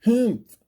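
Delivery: high, loud scornful humph; sour humph. Acted. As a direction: snort it out in disdain. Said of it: "humped a voice".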